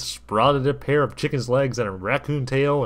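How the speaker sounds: plain reading voice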